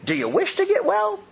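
Jesus to the lame man by the pool, a pointed high question; pointed personal question. Retold or imitated. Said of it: "very pointed personal question".

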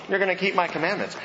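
normal talking voice

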